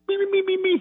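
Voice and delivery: high squeaky Beaker meeping; Beaker squeak. Retold or imitated. Mocking a mad coach as squeaky Beaker. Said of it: "he sounds like Beaker".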